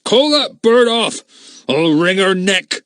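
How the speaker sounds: high, loud, slow pained yell; yelling loudly. Acted. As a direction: roar at the bird in pain. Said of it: "he yelled loudly".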